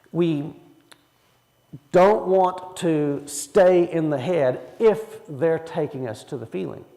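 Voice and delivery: ordinary talk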